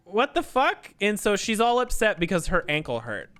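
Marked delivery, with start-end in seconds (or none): 0.1-0.9 incredulous. Retold or imitated. Her own reaction, retold.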